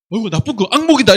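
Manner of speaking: loud, exclaimed groan about a nightmare; exaggerated bad-dream outburst; loud mock dismay. Acting out a rejected reaction to dream.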